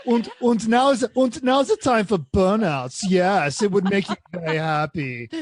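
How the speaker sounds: put-on hammy German-accented car; bad German accent. AMG car proposing burnouts, in a bad German accent. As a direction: urge mischief in a mock German accent. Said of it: "I'm doing a bad German accent".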